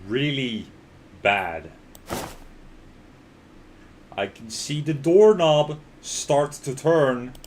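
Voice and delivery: ordinary talk, nothing marked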